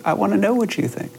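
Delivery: high, eager imitation of curious questioners; insistently curious. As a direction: imitate someone dying to know, pitch shooting up. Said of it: "they just became more curious".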